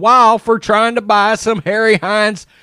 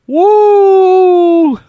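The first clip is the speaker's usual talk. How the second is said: loud high gleeful woo; excited whoop; 9 semitones higher, 6 dB louder than usual. Real, not acted. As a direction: holler with delight. Reacting to Jerry scandal tweets.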